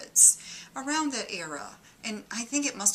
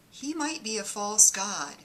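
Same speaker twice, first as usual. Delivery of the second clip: ordinary delivery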